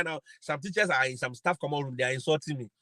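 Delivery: ordinary delivery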